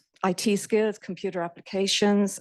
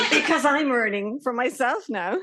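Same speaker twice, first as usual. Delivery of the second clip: higher, with a proud laugh; 6 semitones higher, 6 dB louder than usual. Real, not acted. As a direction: laugh with pride at the joke. Laughs proudly about her kids.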